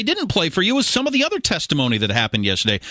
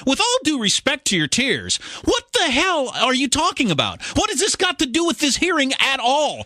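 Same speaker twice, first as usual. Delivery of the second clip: indignant retort; 7 semitones higher than usual. Pretend. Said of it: "if I had been there as a congressman".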